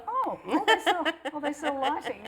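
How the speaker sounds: high, laughing amazed gasps; amazed passerby. Retold or imitated. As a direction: gasp like a startled passerby. Tram passengers voiced, laughing.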